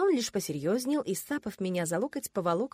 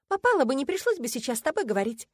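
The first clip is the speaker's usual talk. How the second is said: raised, irritated retort; snapping back; 6 semitones higher, 4 dB louder than usual. A narrator's reading. Heroine sparring with her fiancé, snapping. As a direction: keep the comeback high and cross. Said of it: "огрызнулась я".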